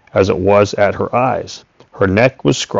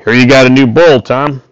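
loud, higher call across the field; shouted; 4 semitones higher, 6 dB louder than usual. A narrator's reading. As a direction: throw it out loud to him. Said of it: "then shouted".

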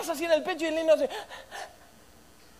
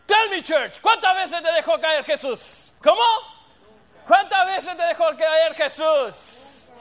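slow, loud preacher's challenge; preaching; 3 semitones higher, 6 dB louder, slower than usual. Acted. Preacher asking the church how often Jesus dropped them, slow and loud. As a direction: drag the question out to the church.

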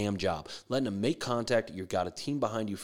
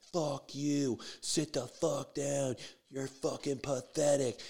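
restrained mock yell; 3 semitones higher, 5 dB quieter, slower than usual. College pitcher yelling from the mound, restrained mock yell. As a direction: imitate a far-off yell, held back. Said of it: "yells at him".

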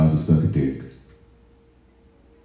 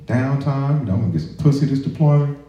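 excited; 10 semitones higher, faster than usual. Retold or imitated. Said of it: "I'm like".

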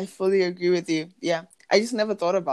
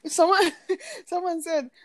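laughing; 10 semitones higher than usual. Real, not acted. Laughing while reading comment.

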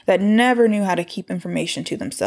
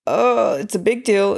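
normal talking voice

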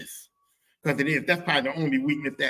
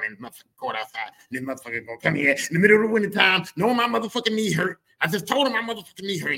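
indignant dad rant; 6 semitones higher, 5 dB louder, faster than usual. Retold or imitated. Dad's complaint, rising indignation.

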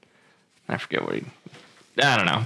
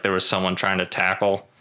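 ordinary delivery

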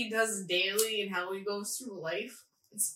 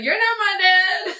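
laughing mock sulk; 10 semitones higher, 13 dB louder than usual. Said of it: "He's like".

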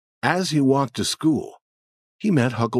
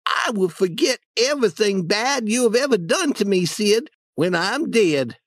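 put-on dramatic boy voice; 11 semitones higher than usual. Tom fakes dying.

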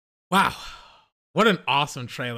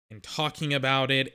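ordinary talk, nothing marked

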